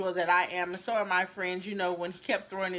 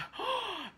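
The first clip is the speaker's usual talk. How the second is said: shocked gasp; 14 semitones higher, 6 dB quieter than usual. Retold or imitated. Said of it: "everybody in line was like".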